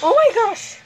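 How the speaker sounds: high, loud startled oh my gosh; shocked squeal. Real, not acted. Kid gamer startled by enemy, high squeal. Surprise in game.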